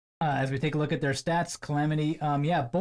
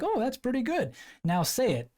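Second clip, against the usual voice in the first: impressed French friend; 9 semitones higher than usual. Retold or imitated. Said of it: "And he's like".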